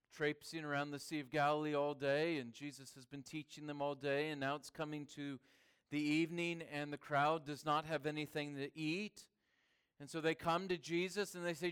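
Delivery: ordinary talk, nothing marked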